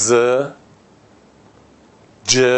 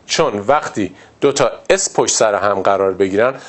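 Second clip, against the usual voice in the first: ordinary delivery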